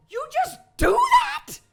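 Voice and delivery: very high, fast disbelief; incredulous squeak. Acted. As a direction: squeal it in shock. Shocked at instant shapeshift.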